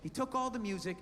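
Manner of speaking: imitating wife. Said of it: "She goes".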